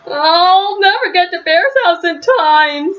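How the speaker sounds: high, slow, sobbing little voice. Acted. Snail despairs to Franklin about being late, sobbing. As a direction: wail it out through tears, slowly. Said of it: "sobbed Snail".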